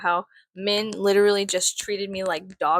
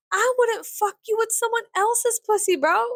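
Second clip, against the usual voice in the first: much higher, sassy mom voice; quoting mama; 11 semitones higher than usual. Retold or imitated. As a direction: deliver mama's insult with relish. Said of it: "like my mama says".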